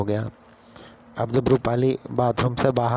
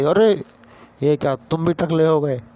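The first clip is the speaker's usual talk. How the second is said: shocked surprise; 5 semitones higher, 5 dB louder than usual. Retold or imitated. Wife sees husband also bald.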